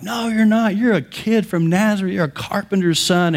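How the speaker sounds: high-pitched mock indignation; scornful crowd rejection. Synagogue crowd dismissing a local carpenter's son, indignant mock protest. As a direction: imitate an outraged crowd, voice jumping up.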